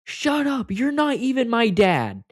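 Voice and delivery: high, loud, slow furious shout; retold furious yell. Teen screaming at his stepdad who jumped in, furious. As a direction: spit it at the stepdad as a cruel blow. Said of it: "and I said".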